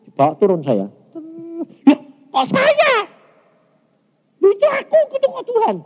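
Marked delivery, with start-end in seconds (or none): 1.8-5.7 very high, astonished outburst; retold shock. Preacher relives seeing himself in the palace, high shocked cry. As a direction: squeal it in disbelief.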